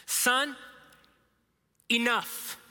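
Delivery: dad voice. Retold or imitated. Dad cutting off pestering son, stern dad voice. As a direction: lay down the law slowly, word by word. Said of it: "best dad voice here".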